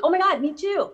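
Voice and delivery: high, excited re-enactment; excited agreement. Retold or imitated. Actress retells agreeing on a Boston accent, excited. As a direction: burst out like you share the same idea. Retelling her delighted reply to co-star.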